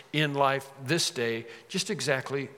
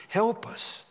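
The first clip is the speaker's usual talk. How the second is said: pleading; 6 semitones higher, slower than usual. Shown as a demonstration. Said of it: "It's a plea".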